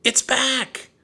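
very high, loud call; excited call. A narrator's reading. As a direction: shout the news from far away. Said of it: "Annie called from the woods".

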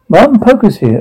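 high excited squeal; excited scream. Acted. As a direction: shriek it with joy. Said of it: "excited screams".

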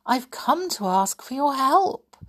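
a hint of a delicate fairy voice; silvery voice. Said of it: "said Alyssa in a silvery voice".

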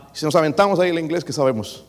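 usual speaking voice

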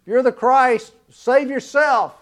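jeering taunt, drawn out; the crowd mocking Jesus on the cross, sneering. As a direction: throw the taunt up at him slowly. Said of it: "the crowd mocking Jesus".

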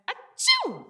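high-pitched "achoo"; squeaky, spoken-out sneeze; acted reindeer sneeze. A narrator's reading. Rudolph sneezes snow out.

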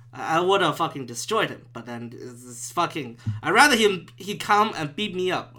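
ordinary delivery

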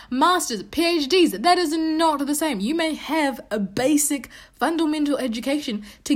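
heated rant. Real, not acted. Ranting about Nick's education claim.

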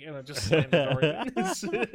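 slow, loud, laughing. Real, not acted. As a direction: laugh as you tell it. Laughing at the scene.

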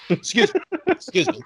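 ordinary talk, nothing marked

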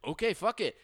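high, loud reckless shrug; mock giving in. Friend caving before dropping the car, high mock reckless. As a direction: give up and go for it. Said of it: "And he's like".

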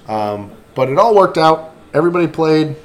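normal talking voice

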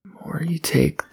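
whispered under breath; whispering. Real, not acted. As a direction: whisper the riddle to yourself. Repeats the riddle to himself.

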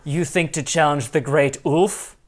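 ordinary talk

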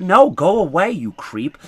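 loud, quick shout of disgust; shouting someone away. Retold or imitated. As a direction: yell at a creepy caller. Customer shouts at clerk.

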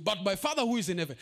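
ordinary delivery

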